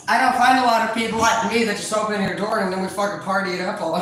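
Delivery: usual speaking voice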